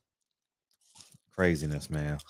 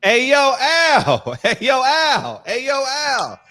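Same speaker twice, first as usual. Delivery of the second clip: loud, high laughing hail; calling out Al; 8 semitones higher, 7 dB louder, much slower than usual. Acted. Host jokingly yelling at absent boss Al. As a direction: yell for Al across the room.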